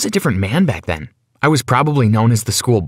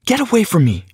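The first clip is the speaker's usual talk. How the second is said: sharp, much higher snap at him; snapped; 9 semitones higher than usual. A narrator's reading. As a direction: bite the words off at him. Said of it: "she snapped at me".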